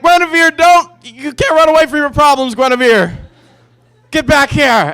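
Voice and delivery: high, loud mock yell; hammed-up shouting after her. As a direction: call her back like a melodrama. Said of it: "I was like".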